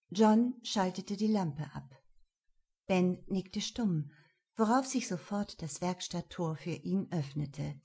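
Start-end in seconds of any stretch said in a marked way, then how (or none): none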